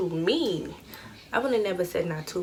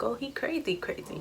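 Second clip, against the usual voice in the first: hushed inner voice, much softer; softer inner-monologue delivery; thinking it to herself; 3 semitones higher, 8 dB quieter than usual. Retold or imitated. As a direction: mutter it under your breath, private thought. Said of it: "in my mind".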